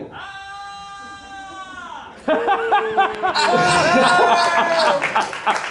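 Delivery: high squealing horror scream; Alf-like scream. Pretend. Showman's fake horror shriek for the crowd. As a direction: shriek like a movie victim gone silly. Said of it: "He sounded like Alf".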